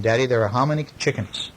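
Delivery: ordinary delivery, nothing marked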